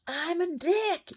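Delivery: very high, drawn-out loud crow; imitating a rooster's crow. Woman repeats what her rooster is saying, imitating the crow. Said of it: "cock-a-doodle-doing".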